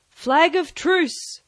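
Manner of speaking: high, slow drawn-out call; crying out. Acted. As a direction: hail it across the clearing, high and slow. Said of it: "cried Silver".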